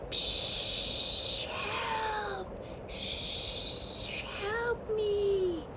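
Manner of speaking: high, airy whooshing cries; wind voice. A narrator's reading. Wind calling to the chick, high and airy. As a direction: call like wind in the branches. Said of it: "heard another sound".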